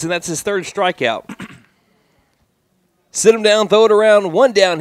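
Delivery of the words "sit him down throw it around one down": excited call. Real, not acted. Strikeout call, play-by-play.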